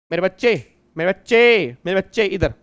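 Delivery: repeated call, loudest in the middle; calling for attention. Real, not acted. Teacher calling a student back to the example, insistent. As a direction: call out to pull a drifting pupil back.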